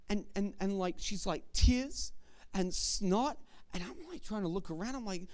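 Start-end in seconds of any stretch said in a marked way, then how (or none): none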